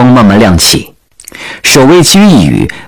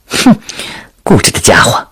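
huffy scoff; 3 semitones higher, 3 dB quieter than usual. Acted. Princess scoffs at his refusal.

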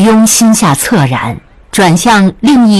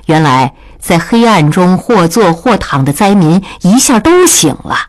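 ordinary delivery

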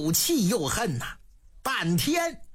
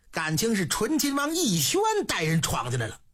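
usual speaking voice

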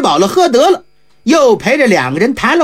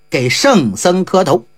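plain reading voice

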